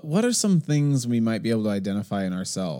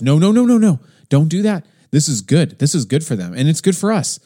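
much higher and louder insistent no; insistent retold outburst; 6 semitones higher, 8 dB louder than usual. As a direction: cut her off loudly. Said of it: "this other person on our trip goes".

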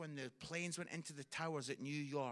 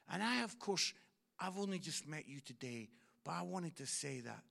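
normal talking voice

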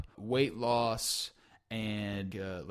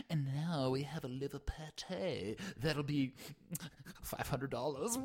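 mock fancy waiter; 3 semitones higher, 7 dB quieter than usual. Playing host at home restaurant.

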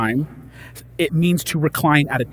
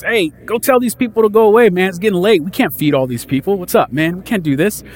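complaining disciples; 5 semitones higher, 4 dB louder than usual. Pretend. Disciples voiced complaining to Jesus.

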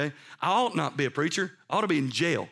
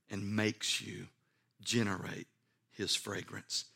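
low, soft, measured appeal; solemn preaching; 6 semitones lower, 4 dB quieter than usual. Real, not acted. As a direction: drop to a grave hush, leaving long pauses. Solemn, hushed appeal in sermon.

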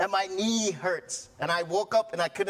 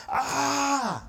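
high, drawn-out mock wail; tantrum wail; 9 semitones higher than usual. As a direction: wail like a toddler throwing a fit. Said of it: "throw a tantrum up at the pulpit".

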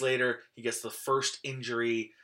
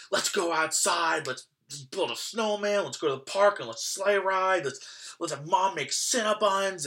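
put-on excited kid; 7 semitones higher, 4 dB louder than usual. Kid thrilled by snow day.

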